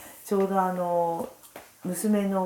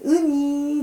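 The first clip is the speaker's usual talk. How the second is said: ウニー held long on a high "ee"; stretched final syllable; 9 semitones higher, 4 dB louder, much slower than usual. Shown as a demonstration. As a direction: draw out the end of ウニー high. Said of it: "ちょっと二が伸ばすんですよ". (ウニーの「ニー」を高く長く伸ばす)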